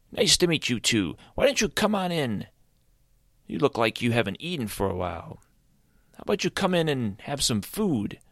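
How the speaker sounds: high, cheerful creature voice; friendly creature. Acted. Azrael introducing himself to Caleb, high and cheerful. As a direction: introduce yourself up high and bright.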